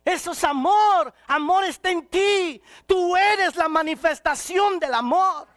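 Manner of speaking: high, loud shouted preaching; preaching at full force. Acted. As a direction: preach it out to the whole church, fervent. (predicación a todo pulmón, gritando)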